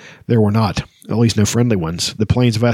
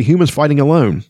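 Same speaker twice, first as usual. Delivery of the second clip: understated fierce exclamation, a little higher; a touch of battle urgency; mildly fierce cry; 4 semitones higher than usual. A narrator's reading. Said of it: "Pirate cried fiercely".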